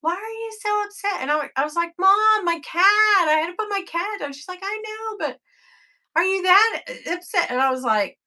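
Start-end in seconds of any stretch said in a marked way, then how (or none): 0.0-1.2 high-pitched imitation of a mother; mother's puzzled questioning. Retells mother questioning her grief. 2.0-4.3 high, louder mock-distraught plea to mom; retold bawling. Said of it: "just bawling and being". 4.6-7.5 high-pitched imitation of a doubting mom; mother's disbelief.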